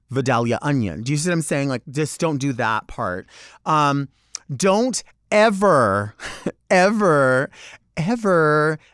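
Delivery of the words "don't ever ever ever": ever drawn out slow, each ever hit; stressed ever. Real, not acted. Warning fans not to touch, stressing ever. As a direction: lean hard on every ever. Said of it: "repeated ever for emphasis".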